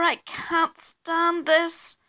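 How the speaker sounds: high, very slow wailed complaint; exasperated bear. A narrator's reading. Mister Bear woken by birds and sun, desperately exasperated. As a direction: stretch it out at breaking point.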